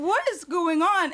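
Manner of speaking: high, slow bewildered question. Retold or imitated. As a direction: drag it out, baffled. Chris bewildered.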